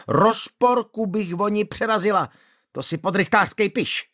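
high, shrill, outraged scolding; shrill angry widow. Acted. Manda scolding the magistrate shrilly. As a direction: play the wronged widow screeching.

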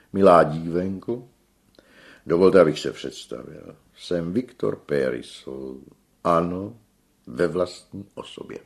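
pompous self-introduction; grand tenor introducing himself. A narrator's reading. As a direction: present yourself as a famous star.

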